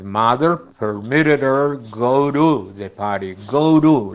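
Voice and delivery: "go to" with the t as a flap; slow example sentence; flapped t. Shown as a demonstration. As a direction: soften the t between vowels. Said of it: "T entre vocales en dos palabras seguidas".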